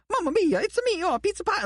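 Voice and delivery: high squeaky Mario impression; jolly Italian plumber voice. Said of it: "ninety minutes of just, like".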